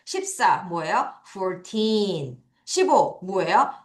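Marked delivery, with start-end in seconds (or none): 1.3-2.4 put-on fourteen with a long, strong TEEN; four-TEEEN, ending held; stress on TEEN. Shown as a demonstration. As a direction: stretch the second syllable of fourteen. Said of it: "틴을 강하게 읽겠습니다".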